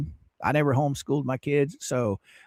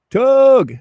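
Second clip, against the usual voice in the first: calling out; 9 semitones higher, 9 dB louder than usual. Real, not acted. Calling out to a viewer.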